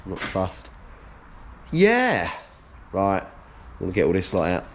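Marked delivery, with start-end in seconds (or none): 1.7-2.3 pumped-up cheer. Real, not acted. Psyching himself up to work.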